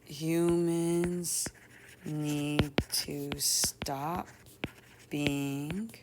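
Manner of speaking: very slow, drawn-out words with long pauses; composing aloud while writing. Shown as a demonstration. Teacher drafting a story's lesson in a journal, slow and halting. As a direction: feel out each word as you write it.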